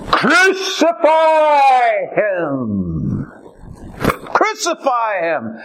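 high, slow drawn-out crowd cry; slow, high mob shout; crowd crying crucify. Acted. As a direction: cry it out like a mob demanding death. Crowd cry voiced in sermon.

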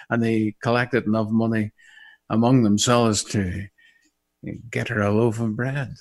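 ordinary talk

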